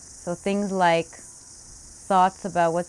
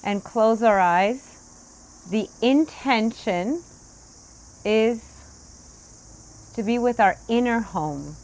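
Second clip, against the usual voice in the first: guided meditation; 4 semitones higher, 4 dB louder than usual. Acted. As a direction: settle the room into stillness. Opens guided sitting instruction.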